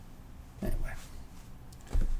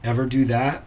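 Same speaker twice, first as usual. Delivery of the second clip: usual speaking voice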